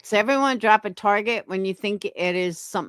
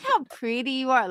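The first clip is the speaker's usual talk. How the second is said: put-on higher, cutesy baby talk; teasing put-on baby voice; mocking baby voice; 4 semitones higher than usual. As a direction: coo it like mock baby talk. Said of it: "Ugh, that baby voice".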